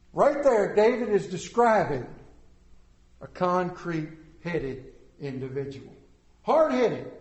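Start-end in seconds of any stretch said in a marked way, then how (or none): none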